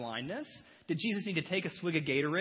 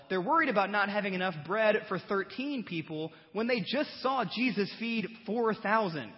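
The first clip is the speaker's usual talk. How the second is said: normal talking voice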